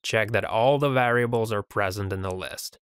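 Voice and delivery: ordinary talk, nothing marked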